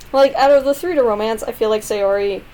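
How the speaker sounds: usual speaking voice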